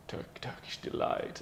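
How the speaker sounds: hushed, whispered exotic sales pitch; imitating a breathy advert voice-over; advert man's voice. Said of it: "the guy would speak like this".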